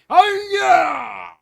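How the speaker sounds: loud, high charging yell; bellowed battle cry. Acted. King charging the monster, bellowed battle cry. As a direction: roar the army forward. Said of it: "the king bellowed".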